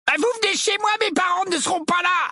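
put-on very high, excited boyish call; loutish gang boy. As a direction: announce it to the gang in a squawking high voice. Gang boy calls to friends. (voix de gamin lourdaud, très haut perchée)

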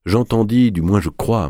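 ordinary delivery

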